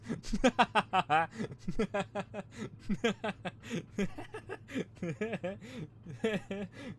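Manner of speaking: high laughing fit, rising; long helpless laugh. Real, not acted. Cracking up at the name.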